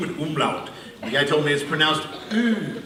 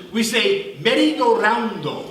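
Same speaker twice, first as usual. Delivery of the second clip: put-on Japanese accent; 4 semitones higher, slower than usual. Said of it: "He said".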